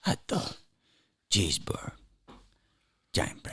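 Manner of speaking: soft, breathless whisper of the three foods; whispered list. Shown as a demonstration. Guest whispering the three food choices back. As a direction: murmur the options under the breath.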